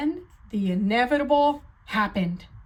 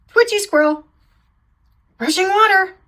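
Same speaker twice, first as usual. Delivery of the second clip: louder, higher alarmed calls; startled cries; 8 semitones higher, 10 dB louder, much faster than usual. Acted. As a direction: shout each fright out. Nervous sheep startled by noises.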